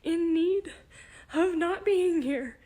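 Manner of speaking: high, loud tearful outburst; words pushed out through real tears; crying. Real, not acted. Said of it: "look, I'm crying".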